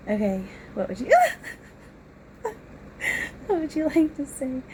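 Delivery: high, loud giggly shrieks while tickled; squealing with laughter as he tickles you; laughing. Real, not acted. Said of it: "Why are you tickling me?".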